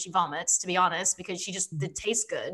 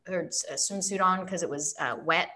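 ordinary talk, nothing marked

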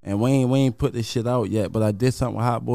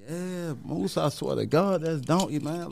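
lightly raised, higher mock voice of the bluffing friend; imitating the friend; 5 semitones higher, 3 dB quieter than usual. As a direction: play the friend swearing it's true, voice pitched up. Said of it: "He like".

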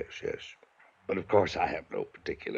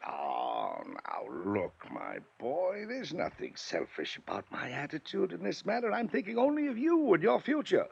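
soothing; 7 semitones higher, 7 dB quieter, slower than usual. Acted. Uncle switches to soothing tone.

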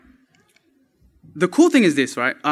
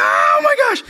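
high, loud screamed outburst; retold scream; 5 dB louder, slower than usual. Speaker screaming alone in a soundproof phone booth, retold scream. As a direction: let it explode, pitch shooting right up. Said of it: "I screamed".

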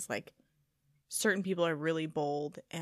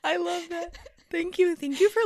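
much higher, delighted gush; thrilled thank you; 13 semitones higher, 7 dB louder than usual. Real, not acted. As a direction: squeal your thanks. Gushing thanks to friend.

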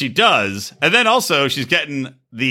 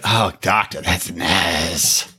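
lower, mock seductive coo; lower, put-on voice, playing the flirt; 12 semitones lower, much slower than usual. Angela quoted reacting to doctor.

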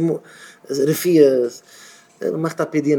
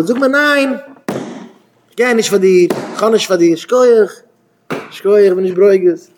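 blunt refusal; 5 semitones higher, 3 dB louder than usual. Acted. Acts out wanting a blunt refusal.

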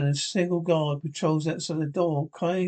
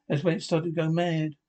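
ordinary delivery, nothing marked

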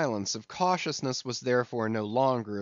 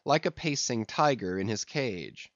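usual speaking voice

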